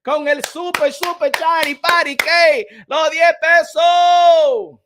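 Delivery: loud, slow, drawn-out ring-announcer call; hype announcer shout. Pretend. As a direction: stretch each name like a ring announcer hyping the crowd.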